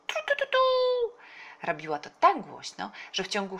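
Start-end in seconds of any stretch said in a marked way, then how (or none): 0.1-0.6 very high, loud toots; imitated whistle. A narrator's reading. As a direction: toot out a whistle signal. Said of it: "i gwizdała". (wysoki gwizd naśladowany głosem)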